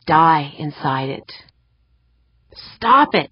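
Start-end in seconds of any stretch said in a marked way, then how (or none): 2.8-3.3 commanding. Acted. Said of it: "she commanded herself".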